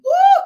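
high whooping cry of praise; ecstatic woo. Real, not acted. Preacher whooping to the congregation, ecstatic high woo. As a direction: let out a joyful woo.

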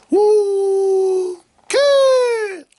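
high, loud hype yell; excited whoop. Real, not acted. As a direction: holler it like a hype man. Hyped cheer, very high.

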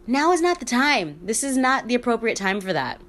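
imitating a high-pitched objection to a badly timed joke; retold protest. Retelling a kid scolding a joking stepdad, mock protest. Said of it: "I'd always be like".

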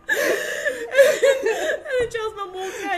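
high, loud, slow through laughter; laughing. Real, not acted. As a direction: crack up while telling it.

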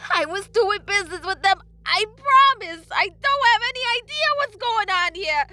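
high, loud tearful wail; desperate pleading. A narrator's reading. Blacksmith pleading innocence to the hooded figure, desperate. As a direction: beg at full cry to be believed.

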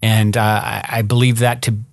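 ordinary talk, nothing marked

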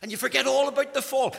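normal talking voice

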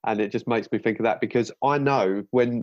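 normal talking voice

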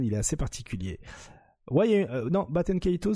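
usual speaking voice

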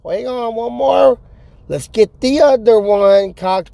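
a hint of a feisty old gal reloading; Ma Kettle. Ma Kettle reloading her shotgun, a slow, lightly voiced old woman. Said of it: "I look like Ma Kettle".

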